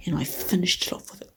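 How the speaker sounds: quiet late-night whisper. Real, not acted. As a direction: keep it hushed, barely voiced. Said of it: "I have to whisper".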